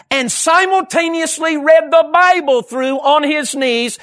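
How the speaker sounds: high, loud, emphatic preacher's delivery; impassioned preaching. Real, not acted. Preacher marvelling at an unschooled man's devotion, impassioned. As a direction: preach it with mounting amazement, stretching key words.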